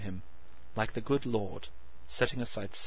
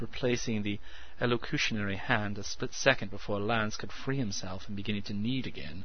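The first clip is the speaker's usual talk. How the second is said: ordinary delivery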